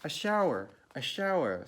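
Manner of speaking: high, loud excited cries; children's mock scream. A narrator's reading. Four children race into the shower, mock scream. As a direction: squeal it out like excited kids. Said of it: "screamed Missus Ralph's four children".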